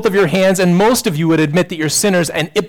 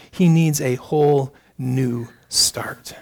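slow, breathy whisper of hope; sermon whisper at the turning point; hushed whisper; 3 semitones lower, slower than usual. Acted. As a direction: drop to a whisper and slow right down. Preacher's hushed climax line.

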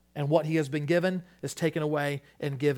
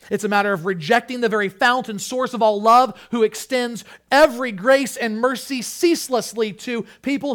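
loud and higher, driving emphasis; full-force preaching; 6 semitones higher, 9 dB louder than usual. Real, not acted. Preacher warning church against rejecting God, full-force preaching. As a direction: drive the charge home from the pulpit.

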